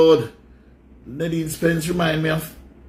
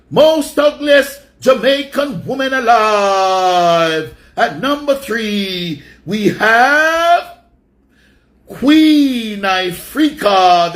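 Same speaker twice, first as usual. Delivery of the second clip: drawn-out louder host voice counting down the list; countdown announcer; 5 dB louder, much slower than usual. Acted. Host announcing the next entry on his list, slow and booming. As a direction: announce it like a chart show host, stretching the words.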